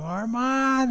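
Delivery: high frantic call; one last desperate cry into the rubble; yelling a name. Retold or imitated. Father calling his son one last time, high yell. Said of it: "and he yells one more time".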